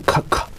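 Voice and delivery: "k" as a hard puff of air; cough-like K. Shown as a demonstration. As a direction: cough out the "k" sound alone. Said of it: "como si tosiera cars". ("k" como si tosiera)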